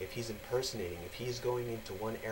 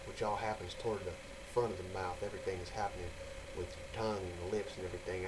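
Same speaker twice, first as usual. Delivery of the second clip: ordinary talk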